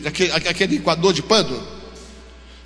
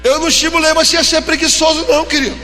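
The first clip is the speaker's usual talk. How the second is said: loud, high fervent preaching; preaching at full force; 7 semitones higher, 3 dB louder than usual. Acted. Pastor exhorting the unemployed, preaching loudly. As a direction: pastor rousing the church, voice raised high.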